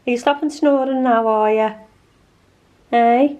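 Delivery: high, loud coaxing pet voice; talking to the dog. Real, not acted. Owner teasing her snoring dog in a high pet voice.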